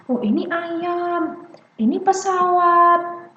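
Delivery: put-on high, slow sing-song to a toddler; parent reading along. Shown as a demonstration. Parent reading a picture book with a child, sing-song naming. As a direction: point things out with long, held vowels. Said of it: "Kita lagi baca buku nih misalnya".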